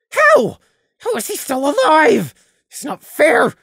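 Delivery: high, loud, indignant rage; raging outburst. Acted. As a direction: rage at the injustice. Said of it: "Prey internally raged".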